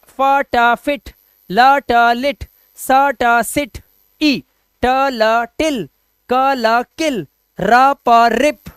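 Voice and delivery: drawn-out nonsense syllables with short i; slow sound drill. Shown as a demonstration. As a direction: chant them slowly like a phonics drill. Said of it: "sound drill demo". (धीरे धीरे ध्वनि अभ्यास)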